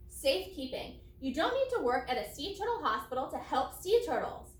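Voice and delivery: normal talking voice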